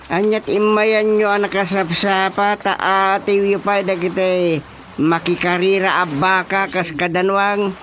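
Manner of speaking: scolding. Acted.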